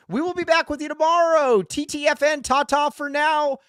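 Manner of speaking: high, drawn-out showman's farewell; announcer sign-off. Acted. Host thanking the chat and closing the show, announcer style. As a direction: send the show off high, stretching the last words.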